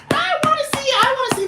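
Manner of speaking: very high squealing fan voice; excited fan plea; starstruck squeal. Retold or imitated. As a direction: squeak it like an eager little fan. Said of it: "I'd be like".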